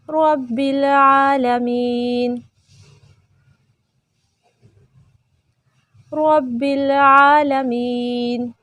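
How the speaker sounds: slow, measured chant with long held vowels; Quranic recitation. Shown as a demonstration. Teacher recites for students to repeat, slow chant. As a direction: intone each phrase and stretch the endings.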